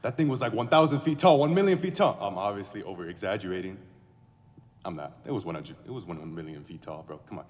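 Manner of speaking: ordinary talk, nothing marked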